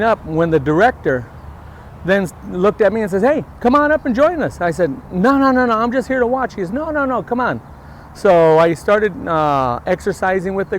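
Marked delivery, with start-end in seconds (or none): 3.2-4.6 higher, louder call to come over; eager mock call across the room; calling out invitation. Director calls him up to join. 5.1-6.5 high-pitched hurried refusal; acted-out bashful objection; retold protest. Retold protest at being invited. 6.7-7.6 higher-pitched insistent coaxing; acted-out friendly insistence; retold coaxing. Director insists in retold exchange.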